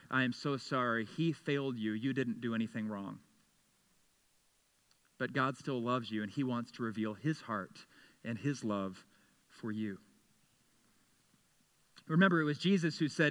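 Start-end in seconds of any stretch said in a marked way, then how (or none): 0.1-10.0 soft, quiet and tender; softly comforting, sinking lower and quieter. Real, not acted. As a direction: gently reassure the wounded. Comforting the hurt.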